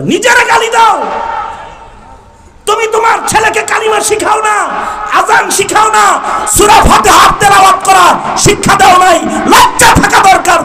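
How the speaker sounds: high-pitched, forceful rebuke; shouted scolding. Real, not acted. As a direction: berate the congregation at full voice. Preacher scolds the congregation. (উঁচু গলায় চিৎকার করে ভর্ৎসনা)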